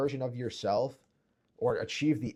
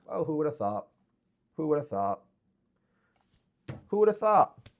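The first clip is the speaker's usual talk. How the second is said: climbing higher and louder sarcasm; sarcastic mock surprise; 5 semitones higher, 6 dB louder than usual. Real, not acted. Sarcastically celebrating the mentorship payoff, rising mock surprise. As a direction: ham up the fake surprise. Sarcastic repeated rhetorical question.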